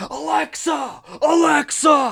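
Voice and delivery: high-pitched calling out; yelling at Alexa. Retold or imitated. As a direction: call the speaker in frustration. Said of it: "remember early days of, like".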